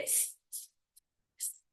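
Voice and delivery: isolated voiceless sss; pure S. Shown as a demonstration. Teacher showing the letter's sound, pure S. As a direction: hold a hissed s, no voice. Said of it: "soa sempre como S".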